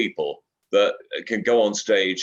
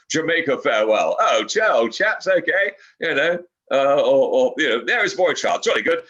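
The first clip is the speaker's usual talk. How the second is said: hammed-up Surrey English, chummy and proper; mock Surrey accent; 4 semitones higher, 3 dB louder than usual. Shown as a demonstration. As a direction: parody a Surrey gent attempting calypso words. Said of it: "sing in a Surrey accent".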